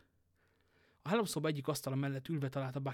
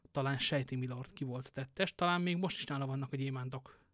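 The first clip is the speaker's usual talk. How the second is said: ordinary delivery, nothing marked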